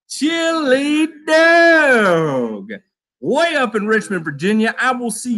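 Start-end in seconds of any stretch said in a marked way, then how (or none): 0.1-2.7 higher, very slow stretched-out name; playful drawn-out viewer shout-out; drawn-out call. Acted. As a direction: sing out Chili Dog like a hype man.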